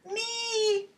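put-on high, eager kids' cry; tiny voices. Children answering the teacher in tiny high voices. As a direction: squeak it like small children. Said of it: "in their teeny tiny voices".

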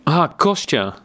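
light up at seeing him; joyful surprise. A narrator's reading. Recognizes brother with joy.

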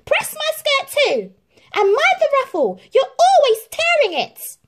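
very high, loud, whiny orders; bossy Drizella. A narrator's reading. Drizella orders Cinderella about her skirt, very high loud. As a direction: nag her shrilly. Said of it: "added Drizella".

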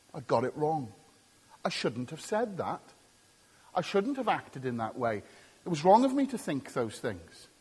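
quiet, low admission of fault; humble confession. Acted. Preacher voices someone owning up to a wrong, humbly and quietly.

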